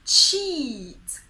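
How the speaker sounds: CHEAT loud and high, EE drawn out; long smiling EE. Shown as a demonstration. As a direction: smile and stretch the EE in CHEAT. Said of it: "So, smile".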